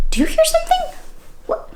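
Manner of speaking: plain reading voice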